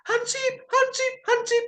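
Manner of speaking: high falsetto calling the name; mock calling out. Girl calling the watchman, high mock falsetto. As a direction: call his name, the plea already fading. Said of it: "lama-lama tolongnya hilang".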